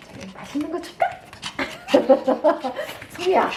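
high excited dog talk; rising excitement at the dog. Real, not acted. Teasing dog with treats.

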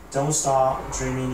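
usual speaking voice

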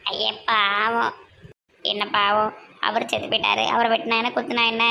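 a gruff village woman, unbothered; low gossiping woman. Acted. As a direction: drop low and shrug it off. Another woman's voice, much lower.

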